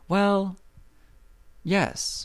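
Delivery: high, hesitant prissy voice; Galinda voice. Retold or imitated. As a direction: do a lightly high Glinda. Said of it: "Galinda's like".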